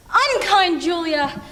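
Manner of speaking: slow, theatrical reproach on "unkind Julia"; bitter self-reproach. Acted. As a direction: punch the UN of unkind, accusing yourself. Turns the name against herself.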